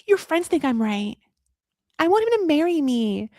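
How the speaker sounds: high mock girlish plea; imitating the woman. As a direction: pitch it up like her insisting. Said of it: "The guy next to him is like".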